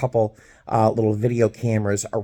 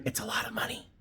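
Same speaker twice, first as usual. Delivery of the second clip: very soft, voiceless whisper; whispered aside; 13 dB quieter, faster than usual. Real, not acted. Telling viewers about gate bag fees, whispered aside. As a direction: confide it under your breath.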